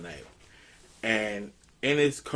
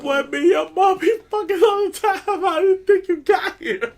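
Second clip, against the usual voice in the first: much higher, laughing through the dad's boast; 7 dB louder than usual. Retold or imitated. Dad telling his embarrassed son how he was made, laughing. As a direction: play the proud father cackling at his son. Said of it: "he said".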